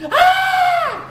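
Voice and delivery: piercing, very high and loud scream; huge yell. Retold or imitated. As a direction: let out a wild scream to scare them off. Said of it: "and that is I yell".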